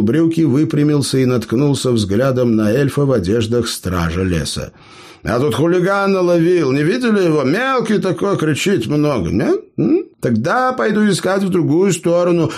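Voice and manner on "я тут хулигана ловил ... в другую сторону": high, quick chirpy patter; rattled off fast. A narrator's reading. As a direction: rattle it off to slip away. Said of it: "протараторил гном".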